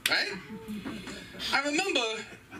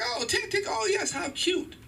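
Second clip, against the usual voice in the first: put-on higher, slow baby talk; cooing parent; slower than usual. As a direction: coo over the toddler's success. Parent praising child, sing-song.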